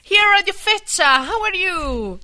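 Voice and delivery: loud, high squealed hello; gushing fan greeting. Acted. Fan greeting caller playing [name].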